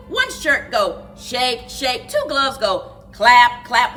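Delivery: plain reading voice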